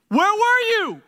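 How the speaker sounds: high, loud scolding; indignant demand. Pretend. Martha confronting Jesus, loud high indignant demand. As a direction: hand on hip, demand an answer. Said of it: "she's like ... all in his face".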